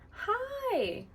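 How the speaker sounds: cheerful high greeting. Shown as a demonstration. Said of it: "you come back".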